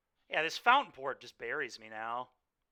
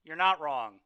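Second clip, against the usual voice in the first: ordinary delivery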